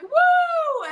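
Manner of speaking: very high, loud whoop; mock party woo; imitated cheer.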